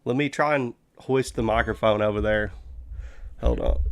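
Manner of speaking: usual speaking voice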